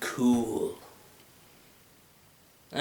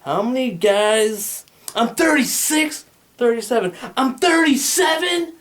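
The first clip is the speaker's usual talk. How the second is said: high, loud, slow exasperated yell; exasperated outburst; 8 semitones higher, 4 dB louder than usual. Retold or imitated. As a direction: blurt it out in disbelief. Exasperated outburst about numbers.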